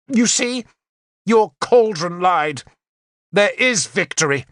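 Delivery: high, gloating taunt, slowing on the last words; triumphant gloat. A narrator's reading. Queen gloating at Morgan over the victory, high and triumphant. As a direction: rub the victory in her face. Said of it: "She turned on Morgan".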